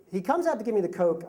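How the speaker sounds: ordinary talk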